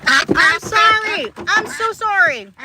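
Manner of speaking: very high, drawn-out apology; apologetic squeal. Real, not acted. Owner apologizing to a startled hen, high pleading voice. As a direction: plead sorry in a stretched-out high voice.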